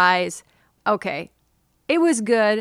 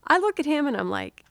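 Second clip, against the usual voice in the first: ordinary delivery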